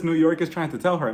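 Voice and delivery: ordinary talk, nothing marked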